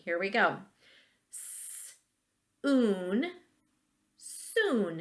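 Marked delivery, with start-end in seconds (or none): 1.3-5.0 S- whispered apart, then oon, then soon joined; blending sounds. Shown as a demonstration. Teacher blending sounds with students, S- then oon then soon. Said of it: "You're gonna do it with me".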